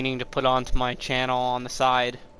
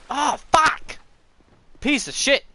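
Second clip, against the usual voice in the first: furious shout, much higher and louder; angry outburst; 13 semitones higher, 7 dB louder than usual. Real, not acted. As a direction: explode at the game. Dies in game, raging.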